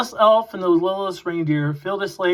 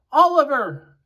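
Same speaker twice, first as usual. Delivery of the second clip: high called name; calling out; 9 semitones higher, 3 dB louder than usual. Acted. An elf calling out to Oliver, high call. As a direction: call his name across the workshop.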